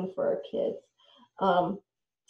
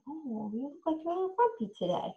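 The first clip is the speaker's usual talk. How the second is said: soft, higher gentle observation; softly noticing a grumpy child; gentle teasing; 6 semitones higher, 6 dB quieter than usual. Shown as a demonstration. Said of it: "You can say".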